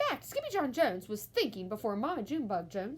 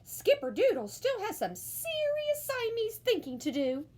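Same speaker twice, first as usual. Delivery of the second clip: mama explaining; 13 semitones higher, 4 dB louder than usual. A narrator's reading. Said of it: "answered Mama".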